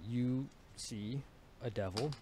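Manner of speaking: ordinary talk, nothing marked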